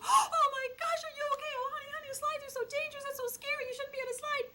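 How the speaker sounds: very high-pitched frantic fussing; hammed-up hysterical worry, way up high; panicked parent. Parent rushing to a fallen child, hammed-up panic. Over-anxious parent reacting to a fall.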